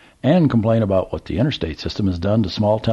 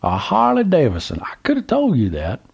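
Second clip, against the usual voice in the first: put-on old Southern gentleman; 8 semitones higher than usual. A narrator's reading. Old man calls to trucker, high Southern voice. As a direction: play the curious old man at the gas pump.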